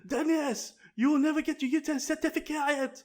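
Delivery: high-pitched, worked-up teacher outburst; mock angry teacher. Teacher telling Daniel off, mock outrage. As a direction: imitate a teacher losing it at a pupil.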